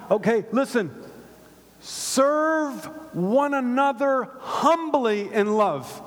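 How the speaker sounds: fired-up pulpit hype; rousing preacher. Acted. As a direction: wake the congregation up.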